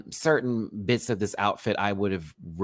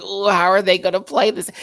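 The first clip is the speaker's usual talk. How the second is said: nervous wondering; 9 semitones higher, 8 dB louder than usual. Retold or imitated. Voices own nervous wondering.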